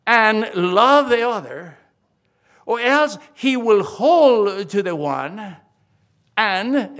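"and" drawn out slow and loud; stress on and. Shown as a demonstration. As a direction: lean hard on "and", slowly. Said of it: "the key word is here is "and".

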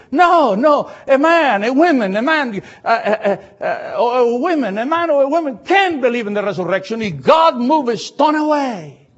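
high, loud fervent preaching; preaching shout. Real, not acted. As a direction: lift the pitch and proclaim it loudly.

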